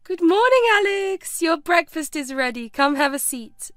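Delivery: cheery, high, loud morning call. A narrator's reading. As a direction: call out high on the greeting, easing into an invitation. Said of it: "called out to him".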